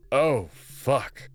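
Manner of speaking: usual speaking voice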